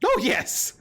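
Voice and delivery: eager hag, lilting and unhurried; hag character. Acted. Hag offers to build them a creature, eager hag voice. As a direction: make a creepy offer in the hag's voice.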